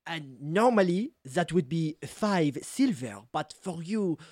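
flattering French salesman; French accent. Acted. Cheese seller giving her a discount, flattering French accent. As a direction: charm the customer in a French accent. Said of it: "French accent and all".

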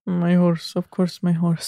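lightly mimicked low catchphrase; put-on low voice. Co-host echoes friend's film quote, in low mimicry.

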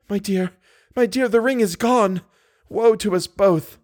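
high-pitched despairing lament; distraught heroine. A narrator's reading. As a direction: wail the bad news high, drawing out the ending. Christine despairs over lost ring.

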